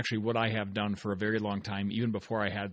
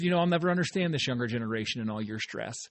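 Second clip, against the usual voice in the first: quick, rattled-off grandmother quote; talking fast; faster than usual. Retold or imitated. Telling listeners about the lively old farm grandmother, fast. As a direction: rush it out like a firecracker. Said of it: "the reason I'm talking fast".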